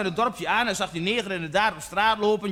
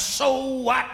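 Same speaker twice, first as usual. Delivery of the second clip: defiant retort; 3 semitones higher than usual. Acted. Janmaat brushing off Stanley, defiant retort. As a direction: throw it back as a challenge.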